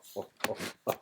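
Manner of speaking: real self-amused chuckle; laughing. Real, not acted. Laughs about dating fifty years ago.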